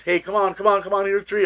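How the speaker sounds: high, sing-song coaxing of a dog with treats. Retold or imitated. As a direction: coax him closer like calling a pet. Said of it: "tried to connive him with treats".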